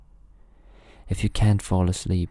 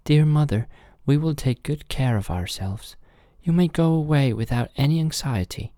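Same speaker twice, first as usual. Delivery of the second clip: lightly voiced higher-pitched kids; little kid goats; 8 semitones higher than usual. Kids promise mother they will take care, little kid voices. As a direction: answer mother as an eager little one.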